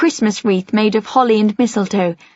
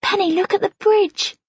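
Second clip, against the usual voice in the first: Mum's voice; 11 semitones higher than usual. A narrator's reading. Mum nudging narrator.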